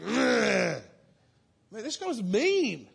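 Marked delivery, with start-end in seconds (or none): 0.0-0.8 grumpy grunt. Retold or imitated. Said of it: "He'd be like".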